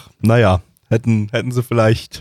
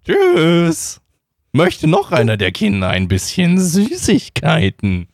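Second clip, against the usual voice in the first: creepy candy man; 4 semitones higher, slower than usual. Acted. Candy man talks to kids.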